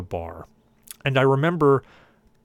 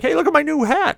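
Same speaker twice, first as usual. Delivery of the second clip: much higher, louder clowning shout; goofy party yell; 6 dB louder than usual. Retold or imitated. Drunk uncle shows family his bra hat, goofy shout. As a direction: show off the bra hat to the room.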